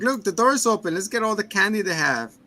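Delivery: high-pitched imitation of an eager child; excited kid. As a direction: pipe up like a kid after candy. Voices excited kid spotting candy.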